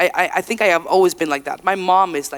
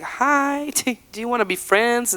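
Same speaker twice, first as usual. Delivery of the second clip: friendly invitation; 6 semitones higher than usual. Retold or imitated. Singer inviting other artists to be friends, friendly and high. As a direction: shyly offer friendship in a high voice.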